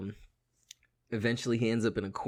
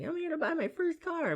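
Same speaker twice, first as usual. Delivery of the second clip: put-on young teen kid. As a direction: play a nervous fourteen-year-old. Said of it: "the kid sounded like he was, like, fourteen".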